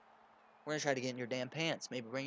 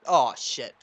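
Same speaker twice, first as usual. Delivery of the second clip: a startled "oh, shit" blurted out much louder; sudden outburst; 13 dB louder than usual. Real, not acted. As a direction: blurt it out as something catches you off guard.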